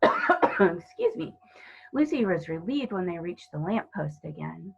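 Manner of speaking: plain reading voice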